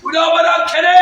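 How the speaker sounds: high, loud cry in tongues; ecstatic shout. Real, not acted. Preacher praying in tongues before the congregation, ecstatic shout. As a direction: burst out in fervent praying.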